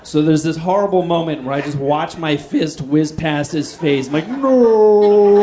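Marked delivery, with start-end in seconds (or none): 4.3-5.4 anguished cry. Retold or imitated. Fist misses its target.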